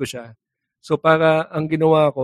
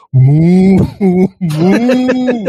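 loud, higher mooing; mock cow moo; 5 semitones higher, 6 dB louder than usual. Erap hiding from soldiers, loud mock moo.

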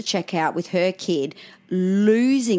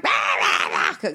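imitating angry toddler roars; 11 semitones lower, slower than usual. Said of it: "he'll be in the pram going".

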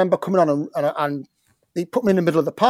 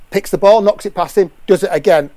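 ordinary talk, nothing marked

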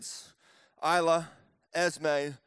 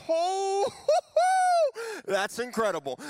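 amazed crowd; 12 semitones higher, 4 dB louder than usual. Acted. Crowd amazed by Jesus' miracle.

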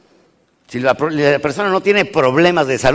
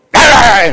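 sudden loud high yelp; a loud high yelp of surprise; startled cry; 10 semitones higher, 10 dB louder than usual. Real, not acted. Startled outburst at audience.

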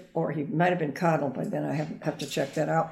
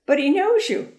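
surprised; 10 semitones higher, 5 dB louder than usual. Retold or imitated. Surprised sister-in-law quoted.

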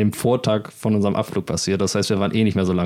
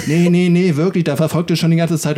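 higher, louder insistent protest; insistent alarm; 6 semitones higher, 3 dB louder than usual. Retold or imitated. Alberto insisting to his friends, louder and higher urgent protest. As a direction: push back hard, he really means it.

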